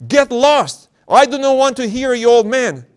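put-on high, loud screaming girl; demon yell. Possessed girl screaming at the elder. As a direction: scream it at the old man. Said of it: "the girl started to yell out".

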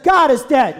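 loud, stark declaration; wife's retort. Retold or imitated. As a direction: drop it like a bombshell. Wife's shocking retort in story.

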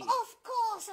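loud, proud of course; excited of course. Real, not acted. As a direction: boast it out loud. Boasting about VIP.

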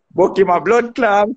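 ordinary delivery

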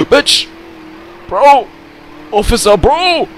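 loud, high panicked shouts; yelling. Real, not acted. Yelling at police in game.

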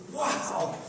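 high-pitched wonder; amazed. Retold or imitated. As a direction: imitate thrilled disciples back from a mission. Disciples return amazed from their mission.

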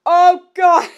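loud laughing groan. Real, not acted. Reader laughing about the gross scene. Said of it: "you heard me had a pretty intense gag".

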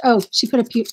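usual speaking voice

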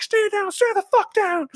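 very high, loud hammed-up order; commanding Waller. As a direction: Waller ordering Bloodsport to stay down. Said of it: "And she's like".

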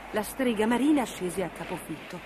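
plain reading voice

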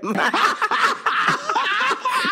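big high-pitched burst of laughter. Real, not acted. Laughing at own joke.

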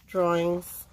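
ordinary talk

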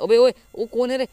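loud, high-pitched challenge; startled shout. Real, not acted. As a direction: yell out in alarm at an unseen attacker. Startled by unknown attacker. (चौंककर ऊँची आवाज़ में चिल्लाना)

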